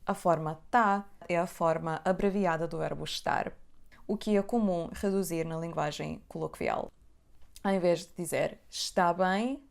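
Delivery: ordinary delivery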